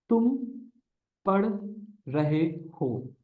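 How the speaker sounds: very slow, each word set apart with pauses; slow dictation. Shown as a demonstration. Teacher dictating a sentence to students, slow and broken up. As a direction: spell out तुम पढ़ रहे हो slowly for students to follow. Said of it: "teacher dictates sentence word by word".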